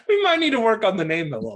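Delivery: giggling at the silly name; laughing. Real, not acted. Host teased about push partners, laughing while answering.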